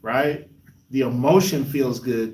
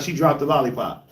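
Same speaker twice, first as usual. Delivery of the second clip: ordinary delivery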